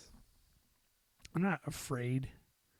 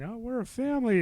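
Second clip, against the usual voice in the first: mock corporate cheer; 9 semitones higher, 4 dB louder than usual. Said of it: "whenever a company's saying".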